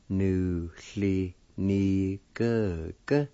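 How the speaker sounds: measured, separated syllables. Shown as a demonstration. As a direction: say each syllable on its own. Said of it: "needs to pronounce like this".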